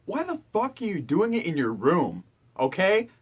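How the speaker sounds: usual speaking voice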